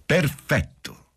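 high, drawn-out cry of triumph; triumphant. A narrator's reading. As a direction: crow it out slowly, triumphant woman. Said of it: "disse subito Lina trionfante".

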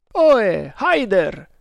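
loud, high hailing cry; calling out. A narrator's reading. Thug hailing the quartermaster in his boat, calling out. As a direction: hail a man across the water. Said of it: "gridò un Tug".

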